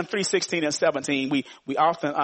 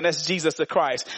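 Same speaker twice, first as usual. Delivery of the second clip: normal talking voice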